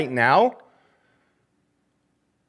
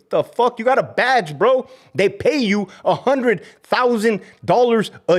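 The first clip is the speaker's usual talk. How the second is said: heated scolding; 7 semitones higher than usual. Real, not acted. Ex-guard scolding colleagues for cowardice, heated. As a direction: chew them out.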